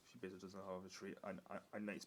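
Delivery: quiet aside. Real, not acted.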